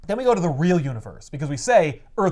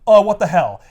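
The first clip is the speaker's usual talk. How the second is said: mock-shocked outburst, louder and higher; shocked retelling; 4 semitones higher, 9 dB louder than usual. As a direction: blurt it out as Superman's dismay. Acting out Superman finding the slaughter.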